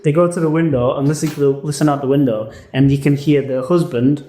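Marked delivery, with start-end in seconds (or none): none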